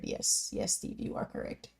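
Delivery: whispered, barely audible; hushed whisper. Real, not acted. Whispering to Steve that his guess was right. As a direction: confide the answer in a breathy hush.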